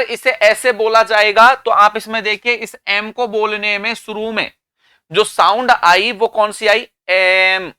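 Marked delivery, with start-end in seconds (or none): none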